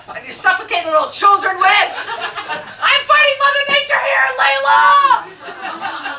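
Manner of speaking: rising into a shout; building comic yell. Real, not acted. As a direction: ramp up to a laughing shout at Layla.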